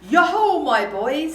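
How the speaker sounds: loud, high jovial call; energetic Fezziwig. Acted. Old boss calling to his boys, hearty and energetic. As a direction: hail the boys with big energy. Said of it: "obviously a lot more energy".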